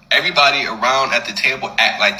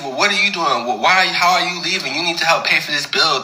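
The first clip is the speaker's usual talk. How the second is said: higher-pitched imitation of challenging questions; mock indignant protest; 5 semitones higher than usual. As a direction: imitate diners objecting, pitched up. Acted protest as he leaves.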